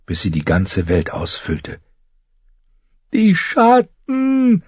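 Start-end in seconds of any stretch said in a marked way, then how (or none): 3.1-4.6 very high, very slow, drawn-out cry; terrified scream. A narrator's reading. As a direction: scream it out in terror, stretched long. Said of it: "schrie sie". (ein langgezogener, sehr hoher Angstschrei)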